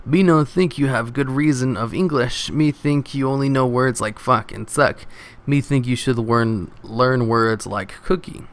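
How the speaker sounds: usual speaking voice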